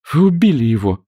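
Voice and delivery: woman exclaiming at the captain. A narrator's reading. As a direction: she cries out at the news. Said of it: "воскликнула женщина".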